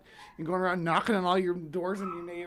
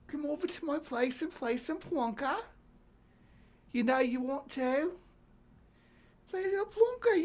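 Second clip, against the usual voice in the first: put-on higher, teasing British accent come-on; flirty British accent; 6 semitones higher than usual. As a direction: coax them in a cheeky British accent. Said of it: "helps me with my British accent".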